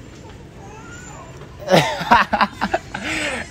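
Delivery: real laughter. Real, not acted. Prankster giving the prank away to a stranger, bursting out laughing. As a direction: crack up mid-argument.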